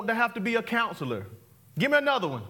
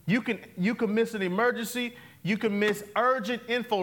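ordinary talk

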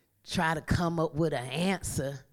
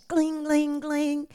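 high chime-like gling; ping it like a light switching on; preacher's sound effect for light; playful light flash sound; 9 semitones higher, slower than usual. Pretend.

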